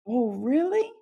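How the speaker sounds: retold jolt of surprise; startled surprise. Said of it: "And I was like".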